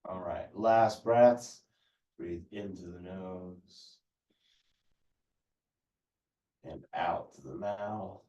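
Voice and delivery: calm, even yoga teacher cues; yoga instruction. Acted. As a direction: guide the class through breaths unhurriedly. Leads a stretching class.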